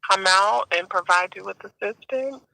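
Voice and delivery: ordinary delivery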